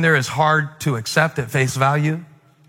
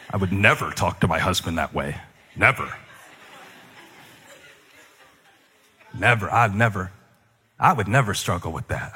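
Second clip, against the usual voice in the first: low, softer, smug self-righteous voice; smug churchgoer; 7 semitones lower, 4 dB quieter than usual. Pretend. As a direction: play the pious know-it-all, softly. Impersonating smug churchgoer, low soft.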